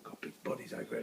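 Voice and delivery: soft whisper near the bunnies. Real, not acted. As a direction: hush it, don't scare them. Quiet farm tour near animals.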